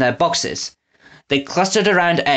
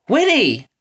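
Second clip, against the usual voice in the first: excited exclamation; 9 semitones higher than usual. A narrator's reading. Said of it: "Eggs exclaimed".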